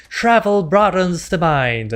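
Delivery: lady's voice. Retold or imitated. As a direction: drawl it like a cheery game narrator. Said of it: "a lady saying".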